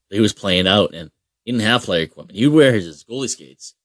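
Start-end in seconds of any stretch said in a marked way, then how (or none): none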